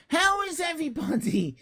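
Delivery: high-pitched, put-on check-in; put-on streamer check-in. Streamer checking in on viewers like other streamers, put-on and high. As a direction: ask it way up high, like a streamer working the crowd. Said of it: "I, I better do it".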